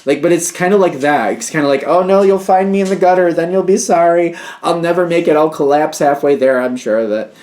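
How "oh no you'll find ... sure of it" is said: put-on higher, louder whiny child, melodramatic; mock kid whining. Reader mocking the stubborn client as a whining kid. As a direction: play a sulking kid who swears they'll collapse. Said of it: "the kid just kinda whining".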